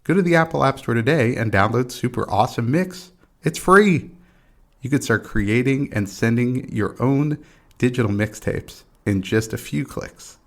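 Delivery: upbeat sponsor ad voice; ad read. Acted. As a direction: sell the app like a radio spot. Reads a sponsor ad.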